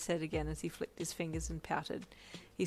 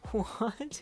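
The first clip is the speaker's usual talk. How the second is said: amused, puzzled, higher question; chuckling confusion; 5 semitones higher, 4 dB louder than usual. A narrator's reading. Patient baffled by the Beyonce talk, amused confusion. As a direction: ask it half amused, confused. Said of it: "you chuckled, confused".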